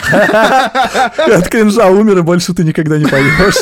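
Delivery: cracking up mid-sentence; laughing. Real, not acted. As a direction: laugh through the words. Mocking own cringe singing. (сквозь смех)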